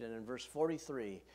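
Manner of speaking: usual speaking voice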